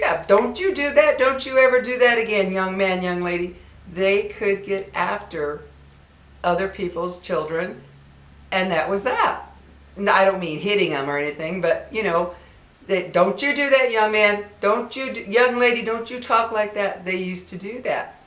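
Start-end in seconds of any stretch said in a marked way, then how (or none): 0.3-3.6 stern, higher scolding; a neighbor telling off a kid. Retold or imitated. Neighbor scolding other people's children. 13.1-17.0 stern, higher scolding; a neighbor telling off a kid. Retold or imitated. Neighbor scolding other people's children.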